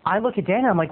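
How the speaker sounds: ordinary talk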